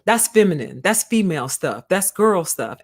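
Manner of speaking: a lightly voiced sneering pundit; mild mock of a pundit's put-down; mocking Kwame. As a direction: scoff at the men like a talk-show host. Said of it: "he's like".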